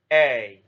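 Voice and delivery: "A" held long, gliding from open to close; teacher modelling the long A diphthong; long A sound. Shown as a demonstration. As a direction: stretch the "A" into its glide. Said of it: "long A sound".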